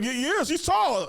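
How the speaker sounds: high, drawn-out exclamation; mock amazement. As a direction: stretch it out high in comic awe. Acts out the guy's emphatic answer.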